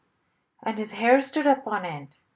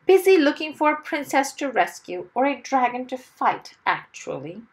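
loud, higher boast; trying to sound important; 6 semitones higher, 6 dB louder than usual. A narrator's reading. Mister Wumble boasting to the airplane man, self-important. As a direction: announce your grand errand importantly. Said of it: "trying to sound important".

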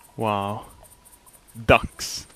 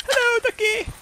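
put-on very high falsetto baby talk; cutesy greeting. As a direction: coo squeakily at a little duck. Cooing at the duck.

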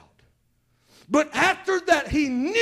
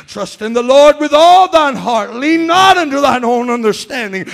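proclaimed scripture; 7 dB louder than usual. Acted. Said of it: "scripture again, loud".

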